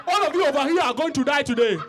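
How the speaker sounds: hammed-up Hollywood version of an African accent. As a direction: declaim in an exaggerated movie African accent. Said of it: "you know that African Hollywood accent".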